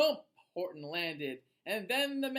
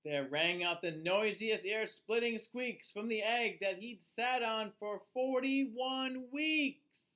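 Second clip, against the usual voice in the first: put-on lively, sing-song read-aloud for children; storybook reading; 2 semitones higher, 5 dB louder than usual. A narrator's reading. Reading a rhyming book to children, lively storyteller voice. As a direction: read it like bedtime story time.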